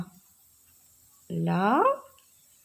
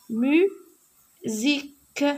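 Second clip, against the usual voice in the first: very slow model word; 4 semitones higher, much slower than usual. Shown as a demonstration. Teacher modelling a dictation word for pupils, very slow. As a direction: stretch "musique" into slow, separate syllables. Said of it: "Mu-, M U S I".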